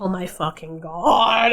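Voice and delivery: exasperated groan. Real, not acted. Exasperated at the clip.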